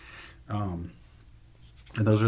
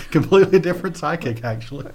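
laughing through the words, higher and louder; 7 semitones higher, 8 dB louder than usual. Real, not acted. Guest laughing as she corrects the host, laughing. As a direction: laugh it out as you correct him.

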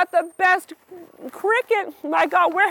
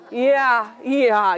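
usual speaking voice

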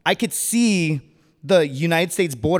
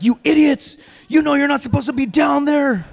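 loud, high bellowing scold; angry marine barking at idiots; drill-sergeant yelling; 10 semitones higher, 5 dB louder than usual. Retold or imitated. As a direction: chew them out like a drill instructor. Marine agent scolds with knife hand.

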